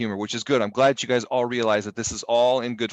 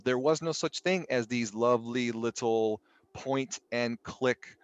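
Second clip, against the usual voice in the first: normal talking voice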